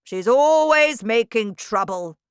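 a hulking tyrant praising her friend; bullying headmistress. A narrator's reading. Trunchbull voiced in story.

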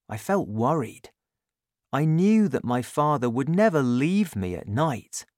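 ordinary delivery, nothing marked

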